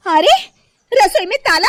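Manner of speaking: loud, high startled exclamation; astonished, pitch shooting up; shocked discovery. Acted. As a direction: cry out in disbelief. Finds the kitchen locked.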